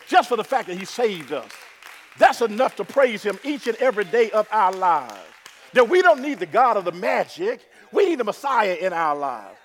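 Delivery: loud, rising sermon climax; full-force preaching. Acted. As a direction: preach it out to the congregation.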